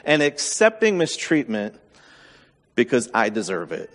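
ordinary delivery

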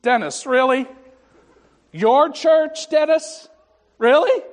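God rolling his eyes at Dennis; exasperated God. Retold or imitated. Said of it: "I'm not making this up".

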